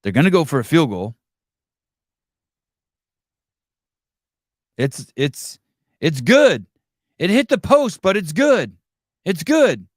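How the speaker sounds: imitating a dull announcer, lightly voiced; deadpan the call like a bored commentator; mocking flat commentator. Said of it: "the commentator goes like this".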